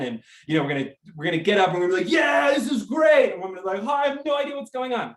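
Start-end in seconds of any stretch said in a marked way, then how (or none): 2.1-3.3 big shouted cheer at the football game, higher and louder; excited stadium cheer. Retold or imitated. Cheering with a friend at a football game, shouted with joy. 3.8-5.1 cheerful clueless fan. Retold or imitated. Confused guest at football game.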